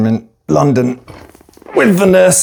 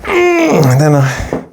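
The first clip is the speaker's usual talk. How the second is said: voice breaking; much slower than usual. Real, not acted. Reliving painful hospital night.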